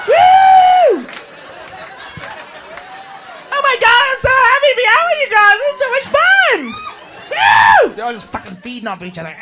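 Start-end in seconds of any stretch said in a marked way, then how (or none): 0.1-1.0 very high, loud woo; squealed cheer; girls'-night whoop; party-girl whoop. Acted. 3.5-6.6 high, excited squeal; acted giddy girl; gushing party girl. Girl gushing to her friends. 7.3-7.9 short loud woo; excited cheer; party-girl whoop. Acted.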